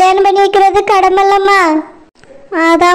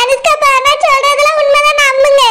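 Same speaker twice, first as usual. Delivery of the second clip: a pleading wife's voice, put on; 7 semitones higher than usual. Wife pleads with disbelieving husband, put-on voice. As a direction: plead your case to a doubting husband.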